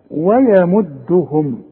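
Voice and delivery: ويمدهم very slow with light و and ي; slow thin recitation. Shown as a demonstration. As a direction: draw out ويمدهم thinly. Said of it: "رقق الواو".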